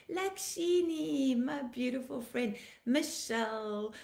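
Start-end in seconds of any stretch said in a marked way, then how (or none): none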